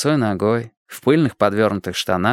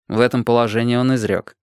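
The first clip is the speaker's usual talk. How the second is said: ordinary delivery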